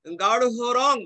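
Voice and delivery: গাঢ় with a laboured rh-h sound before রং; exaggeratedly precise গাঢ় রং, h fully sounded; over-careful pronunciation. Shown as a demonstration. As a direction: overdo the aspirated ending of গাঢ়. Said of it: "মানুষ কি আর ওইরকম বলবে?".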